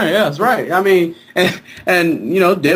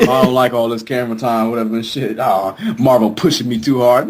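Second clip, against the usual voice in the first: low, mumbled diva whine; mock whiny complaint; 10 semitones lower than usual. Mocking the actor whining about camera time, low. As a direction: grumble like a spoiled star.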